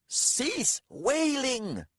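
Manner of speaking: high, drawn-out furious order; angry hiss. A narrator's reading. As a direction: silence the wailer with a strained hiss. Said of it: "Ravast hissed".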